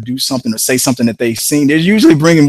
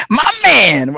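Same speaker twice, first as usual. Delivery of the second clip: high, loud mock "My man"; mock the try-hard greeting; fake "my man"; 8 semitones higher, 5 dB louder than usual. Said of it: "So if you're like".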